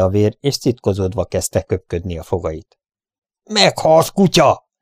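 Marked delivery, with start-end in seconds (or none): 3.4-4.7 high, loud narrated yell; fierce cowboy cry, pitched up; shouted death threat. A narrator's reading. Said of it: "kiáltotta".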